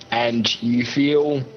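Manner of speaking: usual speaking voice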